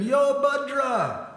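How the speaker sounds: high, loud, drawn-out call; big exuberant shout of greeting; hailing greeting. Acted. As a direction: holler a long hello to a pal across a field. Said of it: "Krishna greeted him".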